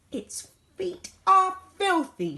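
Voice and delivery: high, loud, slow shriek. A narrator's reading. Mum shrieking at the child about the filthy creature. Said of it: "shrieked Mum".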